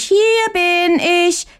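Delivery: "hier bin ich" very high, slow, crowing; drawn-out crow. A narrator's reading. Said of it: "ruft aus Leibeskräften". ("hier bin ich" langsam und sehr hoch gekräht)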